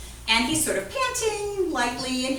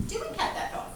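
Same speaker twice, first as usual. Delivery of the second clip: much higher, softer and laughing; incredulous re-ask; 10 semitones higher, 7 dB quieter, faster than usual. Shown as a demonstration. Teacher hearing a wrong answer, re-asking in laughing disbelief. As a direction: ask again as if they can't mean it.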